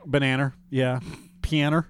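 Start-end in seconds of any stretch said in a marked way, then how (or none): 1.4-1.8 "Pianer" for piano, with an r tacked on the end; Massachusetts accent, end "Pianer" on an r sound; Boston r added. Shown as a demonstration. Friend mocking the Boston accent: "Pianer" with an extra r. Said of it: "they pronounce it when there's not supposed to be anyone there".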